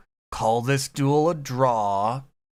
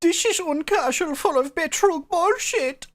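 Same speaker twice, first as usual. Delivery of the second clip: mock German accent. Co-host adds a line to the meme in mock German accent. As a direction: ham up a German lament. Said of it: "I do that accent".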